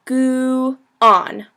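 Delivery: "gu on" drawn out slowly in two parts; sounded out. Shown as a demonstration. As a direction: say "gu" like goo, then "on". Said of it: "like goo".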